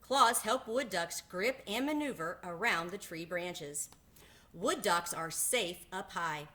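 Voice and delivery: usual speaking voice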